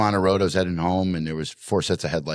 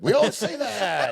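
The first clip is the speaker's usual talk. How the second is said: laughing mortified hush; slower than usual. Retold or imitated. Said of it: "you're just like".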